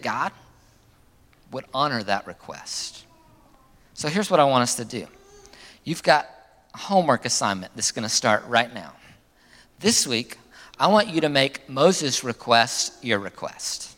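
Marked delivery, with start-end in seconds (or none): none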